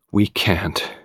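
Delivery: soft, drained of all hope; hollow resignation; empty and hopeless. A narrator's reading. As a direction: let the words drop, defeated. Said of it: "His voice was empty and hopeless".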